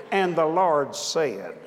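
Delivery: slow, laid-back imitation of a calm preacher; lightly mocking the calm Andrew Womack delivery, slow; imitating Andrew. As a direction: drawl it out the way Andrew talks. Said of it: "start talking like Andrew".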